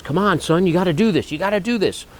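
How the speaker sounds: lightly voiced father begging his boy; gentle push, mock-urgent; coaxing dad. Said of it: "he wasn't mean to the kid, but he goes".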